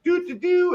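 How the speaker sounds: high tooted horn noise; high-pitched mock fanfare; imitated horn blast. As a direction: toot like a little horn, way up high. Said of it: "it'll blow the horn".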